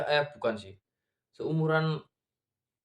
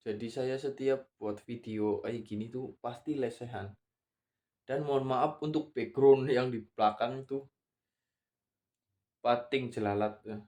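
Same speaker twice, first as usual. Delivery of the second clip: ordinary talk, nothing marked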